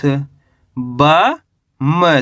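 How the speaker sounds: ordinary talk, nothing marked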